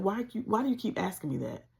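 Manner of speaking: annoyed at repeated questions; irritated. Retold or imitated. Fed up with mom asking, mildly irritated. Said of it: "I'm getting irritated".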